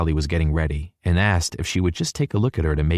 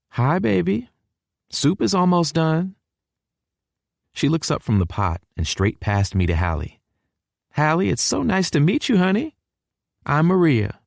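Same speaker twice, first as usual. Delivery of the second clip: higher, sweet welcoming mom; Mama's voice; 11 semitones higher than usual. A narrator's reading. Mother greeting her son and his girlfriend, higher and warm. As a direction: greet them like a doting southern mother, pitched up.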